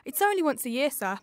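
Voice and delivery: ordinary delivery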